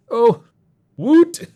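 high, loud squealed whoop; playful retort. A narrator's reading. Shane retorts on learning whose blog it is, playfully squealing. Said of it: "I retorted romantically".

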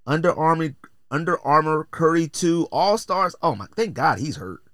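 ordinary talk, nothing marked

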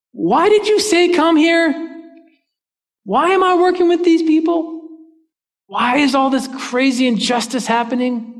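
high-pitched pleading protest; exasperated complaint. Acted. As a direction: whine up at God in frustration. Acts out complaint to God.

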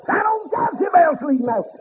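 high ringing preacher's cry; preaching at full force. Acted. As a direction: lift it up and ring it out to the congregation. Preacher whooping, repeated line.

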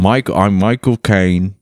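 slow, lightly British Michael Caine voice; a slow hint of a British accent; British accent attempt. Friend trying a Michael Caine impression, British accent. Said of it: "What's his accent?".

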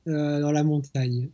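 usual speaking voice

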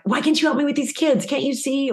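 frustrated spouse. Retold or imitated. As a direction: whine it like a fed-up partner.

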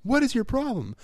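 very high-pitched, drawn-out angry outburst; exasperated yell. Retold or imitated. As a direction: re-enact losing your temper at a kid. Re-enacts losing temper with the boy.

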